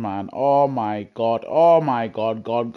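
ordinary talk, nothing marked

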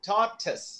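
Tortoise said as TOR-tus; two clear syllables in Tortoise, ending in -tus; correct pronunciation. Shown as a demonstration. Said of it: "I will pronounce the way it should be".

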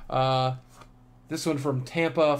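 usual speaking voice

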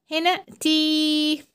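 تي drawn out very long; long slow تي; stretched syllable. Shown as a demonstration. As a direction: hold the ee of تي. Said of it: "مد بالياء".

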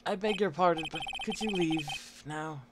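low, soft, formal brush-off; dismissive old lawyer. Acted. Lawyer asking the visitor to leave, low and curtly polite.

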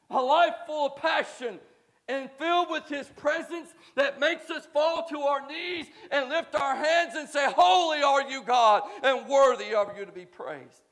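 building from firm to loud, ringing praise; rising preaching. Acted. Pastor stirring the congregation, climbing to a loud peak. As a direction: lift the church up into worship.

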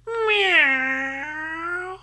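high, slow cat voice with long mew; meowing cat. A narrator's reading. Newly woken cat asks about Tempesta, slow high meowing. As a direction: mew it sleepily, rising to the question. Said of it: "miagolò".

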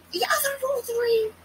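high squeak on "other roll three"; mocking squeak of "the other roll three"; squeaky high imitation. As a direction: mimic it in a squeaky voice. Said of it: "Roll three, roll three".

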